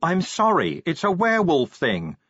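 yelling. Acted. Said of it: "Angua yelled".

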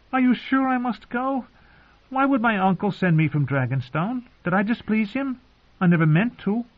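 put-on high, questioning child's voice; worried boy. A narrator's reading. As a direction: plead like an unsure boy, pitched very high. Voices the boy, frowning, unsure.

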